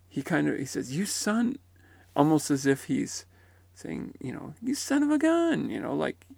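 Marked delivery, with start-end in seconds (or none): 0.9-1.6 higher pitch rising on son; mock amazed greeting, left hanging; imitated surprised recognition. 4.6-5.7 high-pitched playful mock surprise; hammed-up, much higher exclamation; son-of-a-gun attitude.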